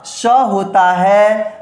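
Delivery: स होता है drawn out very slowly; slow, stressed स होता है; emphatic s. Shown as a demonstration. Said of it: "बहुत इंपॉर्टेंट". (स होता है पर ज़ोर, बहुत धीरे)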